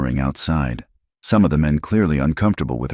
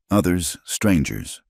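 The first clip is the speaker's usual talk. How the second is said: plain reading voice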